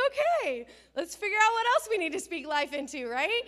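high, loud, eager encouragement; upbeat mom. Retold or imitated. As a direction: pump her up with energy. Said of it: "I'm like".

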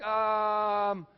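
long drawn-out um; imitated stumped pause; mock long hesitation. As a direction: stall on it as if searching for the answer. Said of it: "like, after five minutes of".

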